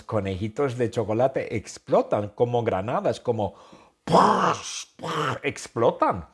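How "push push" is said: high popped push; explosion sound. Acted. Storyteller voicing the bunny grenades exploding. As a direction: burst it out like a grenade.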